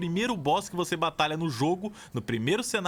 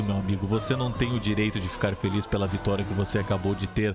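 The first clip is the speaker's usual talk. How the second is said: dramatic narration; 5 semitones lower than usual. Acted. Narrator telling a player his victory is cut short, mock-dramatic. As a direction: narrate the hero's doom in a lowered voice.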